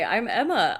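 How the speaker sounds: ordinary talk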